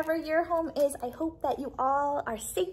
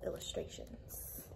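whisper; 14 dB quieter, slower than usual. Real, not acted. Gushing over the book's pictures.

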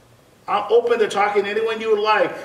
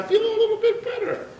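put-on old man; 14 semitones higher, faster than usual. Old man voiced in story.

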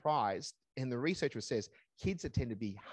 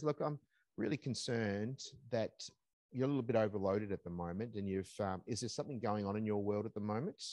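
softer, lower, concerned; gently checking in on a worker; calm concerned boss; 5 semitones lower, 5 dB quieter than usual. Retold or imitated. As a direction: boss asking calmly what's wrong. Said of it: "That's a response".

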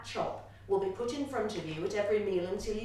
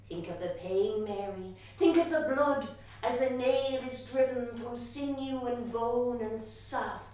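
a hint of a fervent nun, louder on the gory words; stern nun; 7 dB louder than usual. A narrator's reading. Nun to young Mary about the nails, fervent. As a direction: press the horror on the child. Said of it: "Sister Bridget says".

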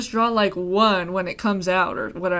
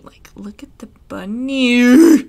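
loud, slow, drawn-out "bunny"; excited showing off; 2 semitones higher, 11 dB louder, slower than usual. Real, not acted.

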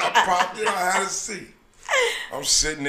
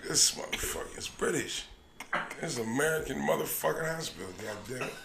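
whispered, much softer thought; hushed aside; 5 semitones lower, 12 dB quieter than usual. Retold or imitated. Whispered private thought while waiting for the doctor. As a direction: mutter it under your breath.

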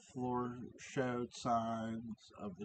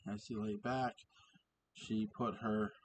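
usual speaking voice

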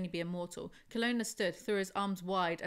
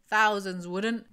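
sarcastic; 8 dB louder, much slower than usual. Real, not acted. Sarcastic reply to the character.

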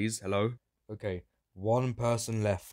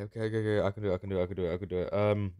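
ordinary talk, nothing marked

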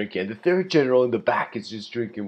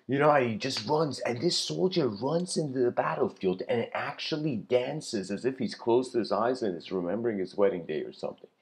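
plain reading voice

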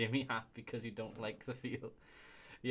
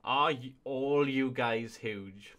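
slow disbelief; 6 dB louder, much slower than usual. Real, not acted. Mock disbelief at reviewers.

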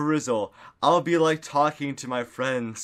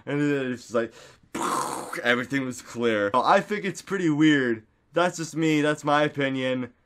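normal talking voice

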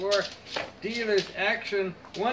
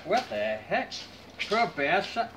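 usual speaking voice

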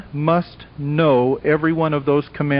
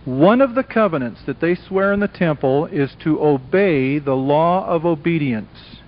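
normal talking voice

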